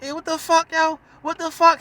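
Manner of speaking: high, loud outburst of disbelief; startled awake. Retold or imitated. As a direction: mimic someone jolted awake and outraged. Davies woken by spit in face.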